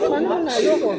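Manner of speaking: high, loud puzzled question; surprised question. Retold or imitated. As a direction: ask in loud disbelief. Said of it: "Sabi ko".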